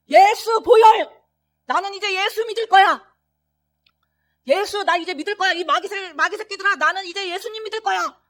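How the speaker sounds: high, loud, triumphant cry; loud declaration. Retold or imitated. Father finally declaring faith to the demons, loud shout. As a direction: finally proclaim it against the demons.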